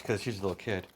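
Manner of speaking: ordinary delivery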